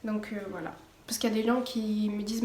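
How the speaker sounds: usual speaking voice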